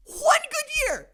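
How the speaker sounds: stretched out high and slow, mocking; sing-song it slowly to rub it in; high mocking drawl. Repeats to mock Minnesota.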